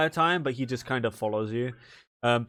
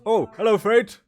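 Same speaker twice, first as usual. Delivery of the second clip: bright, much higher and louder hello; delighted high greeting; 5 semitones higher, 8 dB louder than usual. Acted. Loraglath greeting Fate, suddenly high and loud. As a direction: sing out the hello like spotting an old friend.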